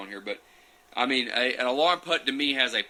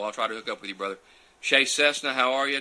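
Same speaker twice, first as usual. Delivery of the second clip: normal talking voice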